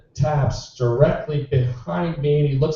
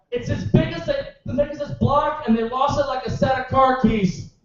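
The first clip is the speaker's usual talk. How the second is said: outraged rant; 9 semitones higher than usual. Real, not acted. Comic rants about the government.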